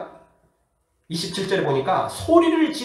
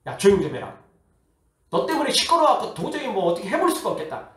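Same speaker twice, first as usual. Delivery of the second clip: raised, irritated mock scolding; acted scolding; 4 semitones higher than usual. Preacher acting the crowd scolding noisy blind men, scolding. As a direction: tell them off as an annoyed crowd would. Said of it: "사람들이 막 꾸짖습니다".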